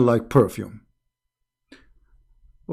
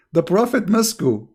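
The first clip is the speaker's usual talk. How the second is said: ordinary talk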